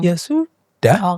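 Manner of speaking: slow, accepting ya sudah; calm acceptance. Shown as a demonstration. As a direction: let it go gently. (orang tua menerima dengan tenang dan pelan)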